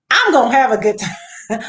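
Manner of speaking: laughing. Real, not acted. Laughing at her own line.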